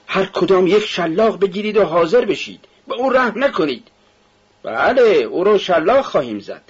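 high, emphatic orders to the crowd; rowdy command. A narrator's reading. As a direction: call it out high, egging them on. Rowdy carter orders a whipping. (دستور بلند و پرهیجان)

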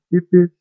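high, loud spoken beep-beep; car horn imitation. A narrator's reading. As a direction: toot like a little car.

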